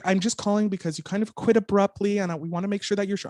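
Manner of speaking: a hint of a concerned manager; mild sweet boss.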